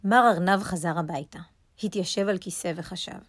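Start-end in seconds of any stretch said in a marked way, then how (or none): none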